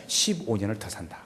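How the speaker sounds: hushed and soft, almost unvoiced; whisper. Preacher softly voicing God's promise to the dying king, whisper. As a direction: breathe God's promise like a secret.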